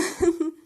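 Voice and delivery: loud delighted laugh; high excited giggle; burst of laughter. Real, not acted. Delighted by tarot card.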